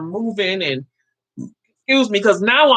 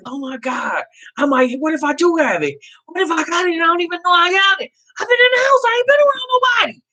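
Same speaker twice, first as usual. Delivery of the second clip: spiralling higher and higher into a mock wail; retold frantic panic; 9 semitones higher than usual. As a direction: act out losing it with worry. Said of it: "I was going crazy".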